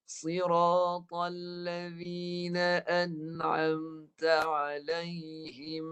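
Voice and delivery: slow melodic tajwid chant, vowels drawn out; Quran recitation. Shown as a demonstration. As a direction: recite slowly, letting each vowel ring.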